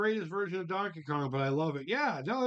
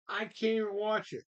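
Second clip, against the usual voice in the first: normal talking voice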